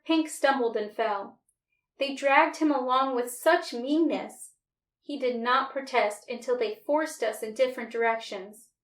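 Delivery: ordinary delivery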